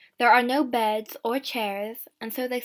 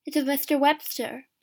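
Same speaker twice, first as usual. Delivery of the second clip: ordinary delivery, nothing marked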